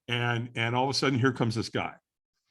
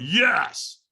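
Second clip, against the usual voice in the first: louder, higher forced yes; raised-voice retold yes; stronger yes; 5 semitones higher, 5 dB louder than usual. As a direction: answer a coach with more effort. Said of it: "And I went".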